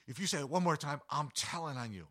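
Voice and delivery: put-on threatening child. Little niece warns her uncle on Easter morning, high and indignant. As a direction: play the indignant kid who will tell on you. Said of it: "And she goes".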